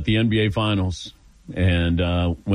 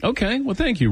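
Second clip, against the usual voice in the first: skeptical wrap-up; 12 semitones higher than usual. Real, not acted. Wrapping up confusing caller.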